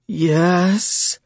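high, drawn-out hiss. A narrator's reading. Lover obeying his order, high hissed reply. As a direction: stretch it high with a sibilant end. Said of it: "she hissed".

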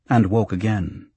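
plain reading voice

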